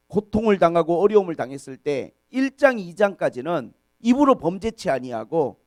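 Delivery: normal talking voice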